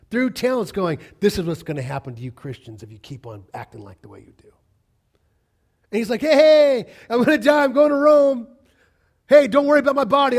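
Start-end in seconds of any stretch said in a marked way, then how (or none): 1.2-4.5 hissed, low threat; menacing whisper. Acted. Mocking crowd parading martyrs. 6.2-10.4 high, loud, eager calling out; cheerful shouting. Acted. Martyr cheerfully calls out going to Rome.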